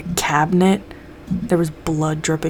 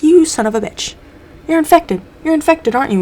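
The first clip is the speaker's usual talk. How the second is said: much higher and louder accusation; accusing shout; 10 semitones higher, 4 dB louder than usual. Retold or imitated. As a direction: corner him, yelling. Group accuses Mulder of infection.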